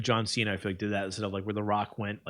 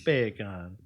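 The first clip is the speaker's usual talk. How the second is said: Jamaican accent. Shown as a demonstration. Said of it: "bacon in a Jamaican accent".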